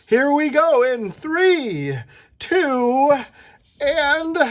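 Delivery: high, slow thrilled countdown; a kid at the top of the roller coaster, counting down; excited countdown. Acted.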